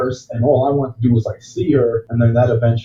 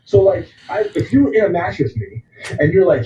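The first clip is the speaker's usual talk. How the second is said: normal talking voice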